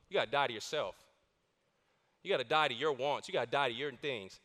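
low repeated charge. Acted.

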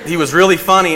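real laughter over a funny prank caller; laughing. Real, not acted. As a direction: fight back laughter while telling it. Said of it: "trying not to laugh".

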